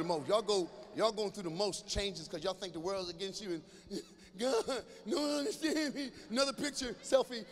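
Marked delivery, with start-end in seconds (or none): 4.4-6.1 put-on moody teen. Mocking a moody teenager.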